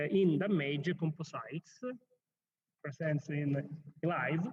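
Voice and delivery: loud, slow conference introduction; formal announcement. Acted. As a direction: announce the next speaker formally. Chair formally announces next talk.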